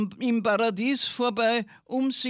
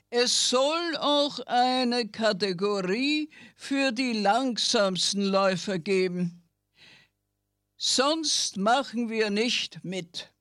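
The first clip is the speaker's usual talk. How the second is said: snail voice. Acted. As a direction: drag it out like a snail. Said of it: "meldeten sich die Schnecken".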